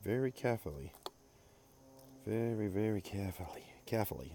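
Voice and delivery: CAREFULLY in his own regional accent, R dropped. Real, not acted. Pouring oil very carefully, in his regional accent. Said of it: "that's my accent here".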